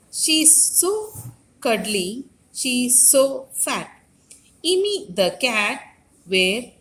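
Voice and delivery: put-on slow, sing-song rhyme read to children; teacher reciting Immi the cat from the book; nursery rhyme recitation. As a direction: chant the rhyme slowly for little kids. Reads nursery rhyme aloud.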